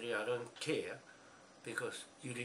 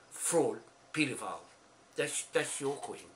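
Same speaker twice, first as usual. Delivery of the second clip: angry shout; 2 semitones higher, 6 dB louder, slower than usual. Real, not acted. Angry insults shouted at the queen.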